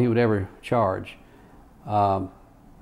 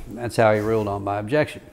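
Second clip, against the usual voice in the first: ordinary talk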